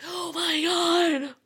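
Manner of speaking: high, loud, slow gasped oh my God; replaying her stunned reaction; mock shock. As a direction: act out being floored, drawing it out. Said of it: "just like".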